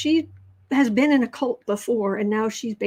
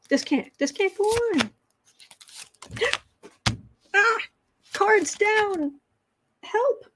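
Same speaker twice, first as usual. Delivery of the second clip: mock panic; 10 semitones higher, slower than usual. Voicing panicked Chief Justice Roberts.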